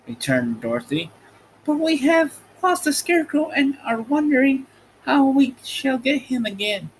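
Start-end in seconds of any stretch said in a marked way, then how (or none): 1.6-6.9 put-on high girlish voice introducing her friends; bright young girl, much higher; Dorothy's voice. A narrator's reading. Dorothy introducing her friends to the stork, high girl voice.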